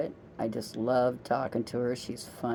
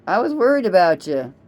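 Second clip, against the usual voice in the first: worried outburst; 4 semitones higher, 6 dB louder than usual. Retold or imitated. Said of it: "And I'm like".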